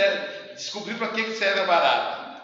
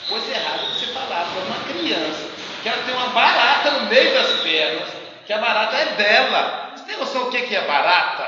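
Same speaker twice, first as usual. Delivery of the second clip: louder, higher scolding; indignant retort; 4 semitones higher, 9 dB louder than usual. Retold or imitated. Uncle snapping back at his sister, loud and indignant. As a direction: push back hard at the sister. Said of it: "Aí eu falei assim".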